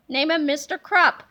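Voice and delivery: ordinary delivery, nothing marked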